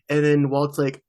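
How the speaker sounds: usual speaking voice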